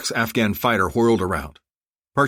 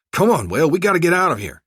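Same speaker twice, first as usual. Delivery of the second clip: loud, high-pitched urging; urgent; 10 semitones higher, 6 dB louder than usual. A narrator's reading. Co-pilot to wounded Will under gunfire, urgent. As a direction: press him to get moving, louder and higher.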